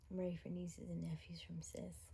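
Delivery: soft whisper. Retold or imitated. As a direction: whisper the quote. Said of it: "she was like".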